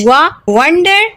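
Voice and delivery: वा, वंडर stretched slowly with round lips; teacher drawing out वंडर; slow w demo. Shown as a demonstration. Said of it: "वा की आवाज निकल रही है ना".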